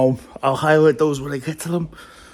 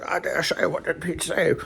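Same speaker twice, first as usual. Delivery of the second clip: softer, higher mock Sean Connery accent; Sean Connery impression; 4 semitones higher, 5 dB quieter than usual. Asking a fellow joker about Pete, mock Sean Connery. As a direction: answer in a put-on Connery voice. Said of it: "started doing this hoi polloi accent".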